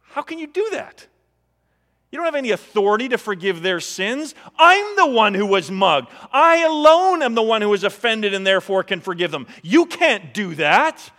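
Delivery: acted indignation, building from high disbelief to loud protest; outraged protest. Imagined mugging victim confronting the preacher, acted outraged protest.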